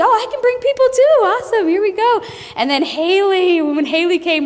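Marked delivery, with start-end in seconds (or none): 0.0-2.2 very high, fast and loud; very high, fast, thrilled voice; excited recruit. Retold or imitated. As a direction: bubbly rush of eagerness. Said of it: "here comes Callie".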